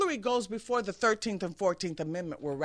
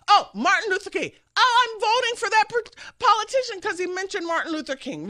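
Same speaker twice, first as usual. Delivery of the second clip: higher, louder naive gushing; mock gullible voter; 10 semitones higher, 6 dB louder than usual. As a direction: gush over a name, hammed-up.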